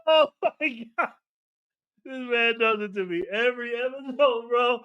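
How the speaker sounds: high, laughter-strained voice; helpless amusement. Real, not acted. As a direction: talk while recovering from a real laughing fit. Recovering from laughing fit.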